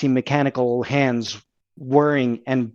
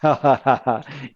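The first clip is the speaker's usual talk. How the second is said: evil laugh. Acted. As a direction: gloat with a dark laugh. Villain laugh before threat.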